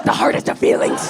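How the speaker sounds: hushed, bitter aside; whispered retort. Retold or imitated. Comedian's private comeback to the landlady, bitter whisper. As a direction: whisper it through a grudge.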